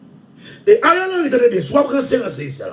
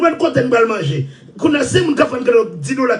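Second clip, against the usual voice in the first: normal talking voice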